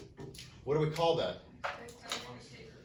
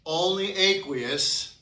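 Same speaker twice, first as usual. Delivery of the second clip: slow and higher, stretched out; emphatic; 4 semitones higher, 6 dB louder, much slower than usual. Shown as a demonstration. Teacher stressing the key rule, slow and drawn out. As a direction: drag it out for the class to remember. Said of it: "emphatic teacher slogan".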